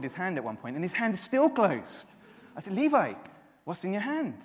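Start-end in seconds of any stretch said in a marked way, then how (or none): 2.7-4.4 higher, sing-song call to his son; father teasing his little son; curious dad. Retold or imitated. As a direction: coax the boy to show his hand. Father questioning son again.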